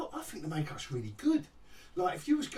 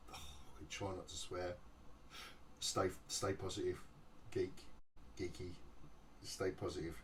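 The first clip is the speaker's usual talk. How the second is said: much softer, muttered reminder to himself; calm yourself down under your breath; quiet self-talk; 13 semitones lower, 15 dB quieter than usual. Real, not acted. Trying not to swear, softly telling himself to stay positive.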